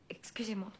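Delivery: soft unvoiced excuse-me; whispered apology. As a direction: slip it out under her breath. Leaving the room.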